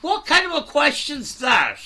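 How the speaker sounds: can't believe what he just heard; exasperated outburst. Real, not acted. Host snapping back at Cecil's question, loud and incredulous.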